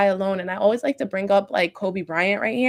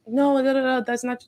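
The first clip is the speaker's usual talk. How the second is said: lightly mocked protest, pitched up; imitated objectors; 5 semitones higher than usual. Speaker imagines listeners pushing back, lightly mimicked objection. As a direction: cut in like a doubter objecting. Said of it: "I could feel people like".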